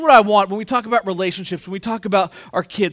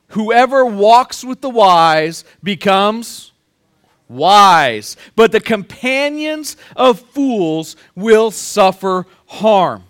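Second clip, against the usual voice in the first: slow, loud, each phrase weighed; solemn scripture reading; 6 dB louder, much slower than usual. Acted. Pastor reads Proverbs to the congregation, slow and loud. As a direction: read the proverb slow and booming. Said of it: "Proverbs thirteen twenty says this".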